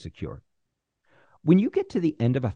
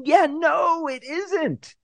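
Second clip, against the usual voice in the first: much higher, breezy brush-off; high mock executive; 5 dB louder, much slower than usual. Teacher voicing a disinterested executive in a high, breezy brush-off. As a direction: toss off a casual no in a high voice. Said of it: "And maybe they go".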